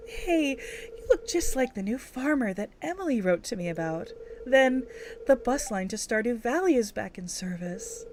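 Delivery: a mature, sweet shopkeeper welcome; grown-up Sandy voice. Acted. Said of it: "she's kind of, like, grown".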